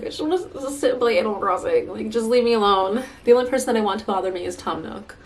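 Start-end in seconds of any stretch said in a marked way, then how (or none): 0.0-3.0 whiny. Retold or imitated. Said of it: "and I'm just like".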